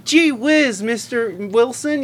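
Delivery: put-on high, eager boy next door voice; gee-whiz innocent kid; wholesome 1950s kid. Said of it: "like this boy next door looking".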